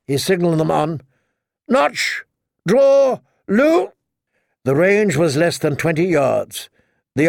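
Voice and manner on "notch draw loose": high, drawn-out firing orders; battle command. A narrator's reading. Knight ordering archers to shoot, calling commands. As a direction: call each order as a separate high cry.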